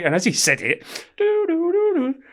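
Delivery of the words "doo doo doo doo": very high sung alarm doo doo; alarm bell imitation. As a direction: mimic the prison alarm bell, pitched way up. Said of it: "alarm bell".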